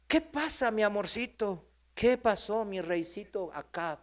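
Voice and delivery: put-on coddle him like a little child; mock babying. Jezabel comforts her sulking husband as a child, mock cooing. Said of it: "Como un niño. Así trata".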